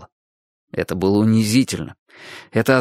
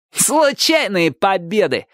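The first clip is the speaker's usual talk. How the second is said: slow, high, cheeky jeer; drawn-out impudent taunt; brazen exclamation; 8 semitones higher, slower than usual. A narrator's reading. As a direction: toss it out insolently. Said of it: "нагло воскликнул он".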